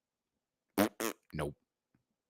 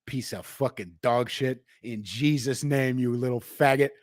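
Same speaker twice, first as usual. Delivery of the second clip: ordinary talk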